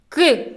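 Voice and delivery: put-on short demonstrated "К" sound for children to copy; isolated consonant. Shown as a demonstration. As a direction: pronounce "К" alone, a quick stop released. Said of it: "Вимовте ці звуки разом зі мною".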